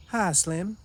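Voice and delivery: lightly voiced flirty hello; a hint of a flirty woman. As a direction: brightly call out to Slim. Greets Slim flirtatiously.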